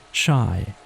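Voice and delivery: ordinary delivery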